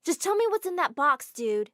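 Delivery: high, loud demand; demanding shout. Acted. Girl loudly demanding the teacher tell her. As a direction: bark it at the teacher.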